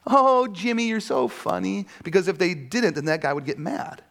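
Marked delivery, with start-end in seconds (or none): 0.1-1.9 fake flattering laugh. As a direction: humor him so he won't get mad. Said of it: "they'd have to laugh".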